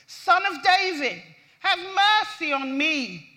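beggar's loud, high cry for mercy. Acted. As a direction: call out for mercy, loud and drawn out. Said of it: "began to cry out and say".

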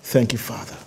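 soft, low thanks to God; quiet reverent aside; hushed prayer aside. Real, not acted. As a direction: murmur a thank-you in prayer. Murmured prayer aside while finding verse.

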